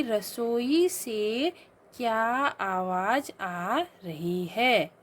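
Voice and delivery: very slow reading of the wrong sentence; slow dictation. Shown as a demonstration. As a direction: read each word slowly for pupils. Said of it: "क्या बोला जाएगा उसके बदले". (धीमा पाठ)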